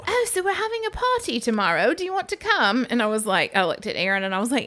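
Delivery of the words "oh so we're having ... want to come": imitating British guy. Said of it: "He's like".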